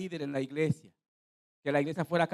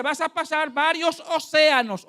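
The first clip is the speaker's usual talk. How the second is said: raised, forceful prophetic voice; prophet's proclamation; 10 semitones higher, 8 dB louder than usual. Retold or imitated. Preacher imitates the man foretelling the ocean crossing, loud proclamation. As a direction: declare it loudly as a prophecy. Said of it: "Oiga lo que este hombre está diciendo".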